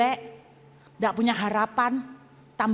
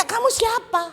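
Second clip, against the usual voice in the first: high-pitched, loud, insistent delivery; agitated shouting; 12 semitones higher, 5 dB louder than usual. Retold or imitated. Preacher re-enacting his demand to the unknown voice, high agitated shout. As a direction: raise the voice high and demand an answer.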